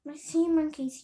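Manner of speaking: loud burst of storybook excitement; excited yell. A narrator's reading. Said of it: "he yelled".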